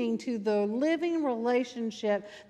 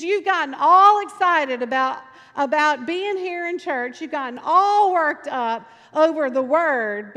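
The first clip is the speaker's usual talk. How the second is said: loud, higher preaching reproof; emphatic rebuke; 4 semitones higher, 8 dB louder than usual. Retold or imitated. Preacher scolding his worked-up church, loud and emphatic. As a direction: come down hard on "all".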